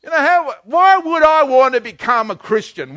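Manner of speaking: usual speaking voice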